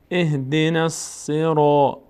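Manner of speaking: "الصراط" with a dark, rounded "ro"; full heavy R. Shown as a demonstration. Teacher shows the letter read full, slow heavy-R recitation. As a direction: chant slowly, filling out the ر of "الصراط".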